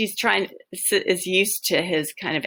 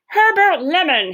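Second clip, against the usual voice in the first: higher, louder, spitting the name; spat name; 11 semitones higher, 7 dB louder than usual. Acted. Manager catches the boy at the desk, spat name. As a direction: bite off the name in anger. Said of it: "he spits my name".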